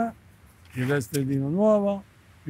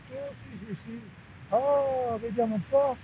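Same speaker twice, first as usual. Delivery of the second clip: excited; 7 semitones higher, 4 dB quieter than usual. Real, not acted. Finding treasure with detector.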